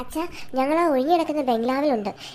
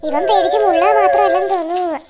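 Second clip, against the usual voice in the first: louder, higher mocking jab; loud teasing; 4 semitones higher, 9 dB louder than usual. Kid loudly teasing the friend who wet himself. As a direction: shout the tease for all to hear.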